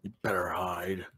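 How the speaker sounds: hushed menacing whisper; creepy whisper. Pretend. To hiding friend, a menacing whisper. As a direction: breathe the threat quietly.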